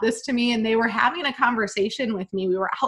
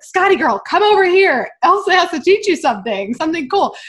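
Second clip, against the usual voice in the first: calling out; 7 semitones higher, 6 dB louder than usual. Retold or imitated. Calling daughter over.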